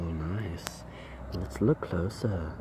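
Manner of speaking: hushed whisper, sneaking closer; breathy documentary whisper. Acted. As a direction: whisper so you don't scare the bug. Creeping up on bug, whispering.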